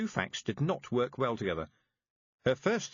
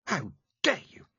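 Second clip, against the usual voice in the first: indignant; 8 semitones higher, 4 dB louder than usual. A narrator's reading. Tiffany furious at the witch.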